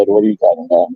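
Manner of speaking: normal talking voice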